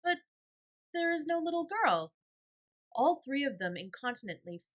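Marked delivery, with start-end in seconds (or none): 0.0-2.1 put-on higher, louder boy; child voice. A narrator's reading. Little boy at the window, higher.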